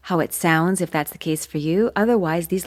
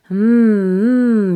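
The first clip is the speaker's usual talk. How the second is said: louder hum; resonant hum; 3 semitones higher, 6 dB louder than usual. Shown as a demonstration. Coach humming to set up resonant speech. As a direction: hum before speaking. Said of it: "you can talk like this".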